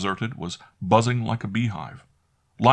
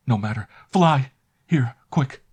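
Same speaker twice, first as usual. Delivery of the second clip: urgent hurry; 6 semitones higher, 6 dB louder than usual. A narrator's reading. Urgent escape.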